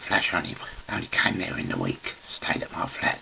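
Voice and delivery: ordinary talk, nothing marked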